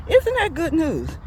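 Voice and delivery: high, lively rhetorical question; delighted exclamation. Real, not acted. Preacher reacting to the verse just read, delighted and high. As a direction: burst out with it, pitched high.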